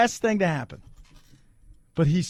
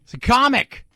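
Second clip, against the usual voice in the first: high, loud insistence on comic; loud emphatic; 8 semitones higher, 4 dB louder than usual. Acted. Repeats defence, loud then quiet.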